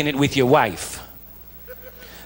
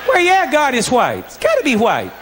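much higher, laughing, mock agreement; sarcastic laughing agreement; 7 semitones higher than usual. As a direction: fake agreeing through laughter.

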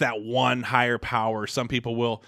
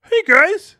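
squeaky high falsetto, loud; mock his friend in falsetto; Craig Bentley voice; 13 dB louder than usual. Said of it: "we got Craig Bentley on the mic".

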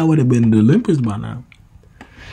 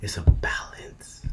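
whisper; 3 semitones lower, 7 dB quieter, slower than usual. Acted.

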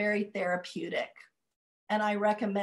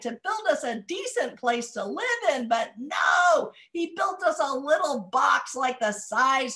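put-on loud, high complaining old woman; grumpy old woman; 6 semitones higher, 9 dB louder than usual. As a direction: kvetch loudly like a grouchy grandmother. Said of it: "I get to be very grumpy".

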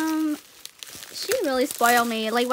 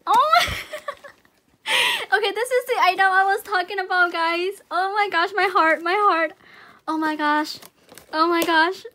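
loud, higher-pitched excited gushing; overwhelmed excitement; 5 semitones higher, 6 dB louder than usual. Real, not acted. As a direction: gush loudly, overcome by the gift. Overwhelmed on opening the awaited gift.

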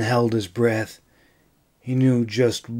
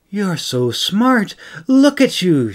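higher and higher, gushing coo; cooing praise; 8 semitones higher, 5 dB louder than usual. Acted. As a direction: praise him like a clever pet, rising to a squeal. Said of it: "she cooed".

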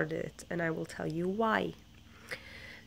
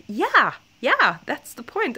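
higher, louder eager agreement; eager teachers; 7 semitones higher, 8 dB louder than usual. Retold or imitated. Imitating teachers insisting that is the point, higher and louder. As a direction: bounce in with enthusiasm.

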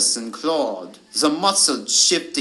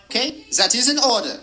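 higher-pitched wolf reply; mildly howled agreement; 8 semitones higher than usual. A narrator's reading. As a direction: lightly howl your consent. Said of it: "Yulia howled back".

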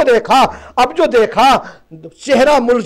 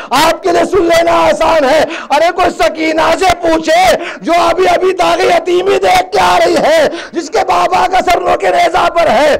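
high, impassioned grieving cry; full-force majlis preaching; 4 semitones higher than usual. Real, not acted. Preacher crying out the tragedy to the mourners. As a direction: preach it out, lamenting.

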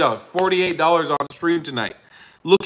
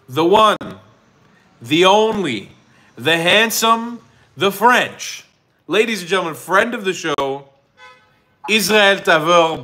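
announcer intro; 4 semitones higher, 3 dB louder than usual. Acted. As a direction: announce the guest like a ring announcer.

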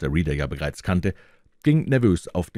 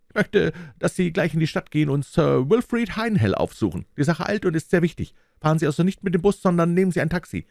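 high, fast and urgent orders; hurried boss voice; 11 semitones higher, faster than usual. A narrator's reading. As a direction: rattle off orders like a pushy boss. Superior gives urgent orders. (hastiger Chef, der Befehle gibt)

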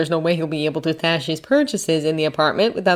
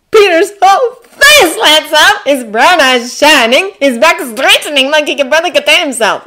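excited narration; 9 semitones higher, 10 dB louder than usual. A narrator's reading. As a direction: narrate it bursting with delight. Narrator mirrors boy's rising excitement.

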